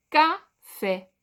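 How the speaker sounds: "Café" split as Ca, fé with a clear pause; syllable by syllable. Shown as a demonstration. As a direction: break "Café" into two separate beats. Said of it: "Palavras com duas sílabas".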